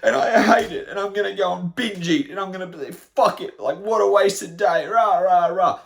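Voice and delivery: loud, high mock tantrum; childish tantrum. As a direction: rant like a child throwing a hammed-up fit. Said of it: "we act like children".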